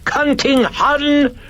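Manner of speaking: put-on piercingly high, slow, loud screech with British accent; hoarse British shriek. Other personality abuses Carl at the door, hoarse British shriek. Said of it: "shrieked in the hoarse British accented voice".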